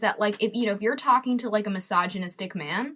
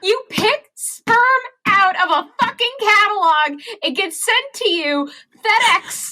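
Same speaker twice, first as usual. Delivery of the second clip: mock-outraged shouting to her podcast host; dick answer; 11 semitones higher, 7 dB louder than usual. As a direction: ham up the absurdity like it's the funniest thing ever. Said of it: "The dick answer is".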